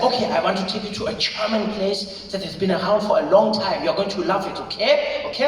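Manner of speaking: put-on German-accented English; eager pitch in a German accent; Rolf. German friend promising a place you'll love, German accent.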